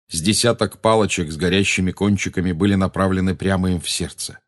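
ordinary delivery

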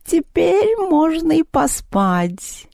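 high, very slow cat voice; sleepy, sated cat. A narrator's reading. Vaska announces his nap after eating, sated cat. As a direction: stretch it out like a drowsy cat.

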